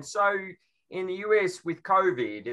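put-on London accent. Londoner asking about the US, imitated London accent. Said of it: "when I talk to people with accents".

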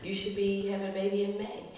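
lightly voiced doctor; a hint of a clinical doctor; doctor's calm report. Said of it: "he says".

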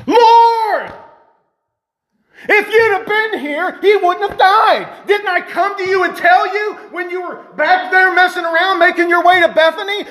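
high, heated reproach; mad at God. Acted. Martha tells Jesus off about Lazarus, mad and reproachful. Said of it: "This is how she said it".

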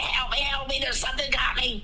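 high, hammed-up begging; mock plea for help. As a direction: imitate a man dragged under.

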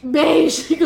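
loud laughing burst; laughing exclamation. Real, not acted. Player reacts to the color beige, laughing. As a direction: call it out loudly through laughter.

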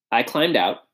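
ordinary delivery, nothing marked

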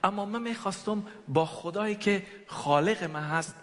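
ordinary delivery

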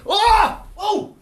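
high, loud "oh!" of someone falling; sudden acted yelps of alarm; startled cry. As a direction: cry out as if suddenly dropping down a hole. Acts Pilgrim falling into hole.

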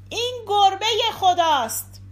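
high, loud proclamation; loud cry. A narrator's reading. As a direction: call it out aloud, pitched well up.

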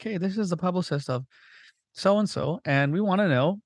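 high, far-off put-on publicist voice, rising to a squeak; mock publicist voice. Publicist leaving voicemail for unknown podcaster, high mock voice. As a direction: play a prissy caller leaving a voicemail.

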